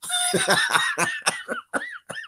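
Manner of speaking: a very high, airy cackle; high cackling laugh. Real, not acted. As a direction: break into a shrill, squealing laugh. Laughs at own joke.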